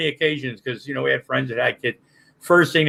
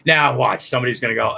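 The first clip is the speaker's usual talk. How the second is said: ordinary talk, nothing marked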